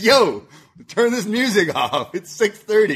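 high, retold shout of annoyance; exasperated call. Roommate woken at six thirty by music, exasperated retold yell. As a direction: call out to a sleeper over blaring music.

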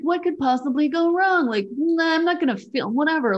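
high, breezy what-could-go-wrong voice; carefree mock bravado. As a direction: brush it all off lightly. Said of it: "I was like".

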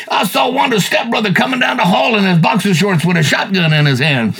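ordinary delivery

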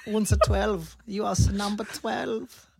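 put-on high, drawn-out German-accented killer; mock German accent. Host playing killer numbering his victims, slow high mock German accent. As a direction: announce it in a hammed-up German accent.

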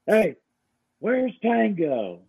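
high, slow Southern twang calling for the dog; imitating Kent's Southern accent; Southern drawl imitation. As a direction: stretch it out in a thick Southern accent. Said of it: "his accent is a hundred percent real".

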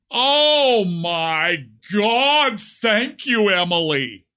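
high, hugely drawn-out fake gushing; hammed-up swooning thanks, pitched high; mock gratitude. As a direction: wail your thanks in sarcastic relief.

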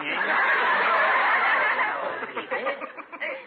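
loud fake hearty laugh, high; hammed-up group laugh; forced laugh. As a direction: laugh on cue at a joke nobody told. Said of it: "Let's pretend we heard a funny joke and laugh".

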